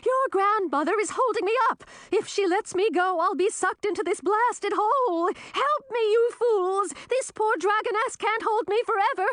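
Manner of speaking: very high, urgent cry; screaming. A narrator's reading. Old woman being sucked into a hole to onlookers, screaming. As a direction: scream desperately for rescue. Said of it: "Frizella screamed".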